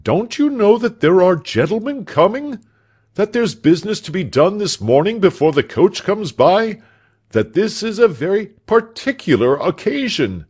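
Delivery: high, loud, exasperated tirade; worked-up employer berating a servant; scolding master. A narrator's reading. Doctor scolds his servant.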